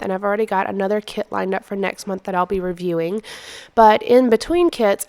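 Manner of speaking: normal talking voice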